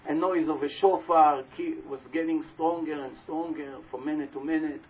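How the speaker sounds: ordinary talk, nothing marked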